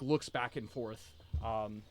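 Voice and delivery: usual speaking voice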